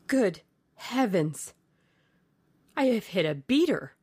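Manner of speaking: mildly horrified outburst; shocked exclamation. Acted. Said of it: "exclaimed Sir Geoffrey".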